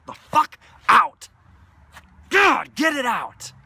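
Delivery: loud high slow shout. Acted. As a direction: scream it out to release. Shouting release.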